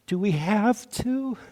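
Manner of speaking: high, soft little whine; whiny voice. Pretend. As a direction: whine it like a reluctant child. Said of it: "a whining voice within some of us".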